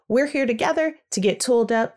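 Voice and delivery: normal talking voice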